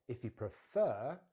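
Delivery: slow "prefer" with a strong "fer"; drawn-out, rising "fer" in "prefer"; stress on second syllable. Shown as a demonstration. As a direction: lean on the end of "prefer". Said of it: "stress is on the fer".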